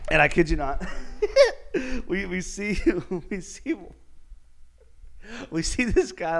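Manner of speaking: giggling mid-story; cracking up. Real, not acted. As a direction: struggle to get it out through laughter. Teller cracks up at memory.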